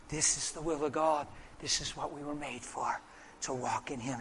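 soft, hushed, heartfelt explanation; quiet, earnest reassurance; tender witness. Retold or imitated. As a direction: speak gently to a child, deeply moved. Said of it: "tears are rolling down your face".

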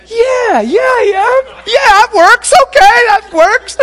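squealed, loud mock agreement; excited high yell. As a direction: yell back overjoyed, pitched very high. Acts his thrilled reaction to the yelling.

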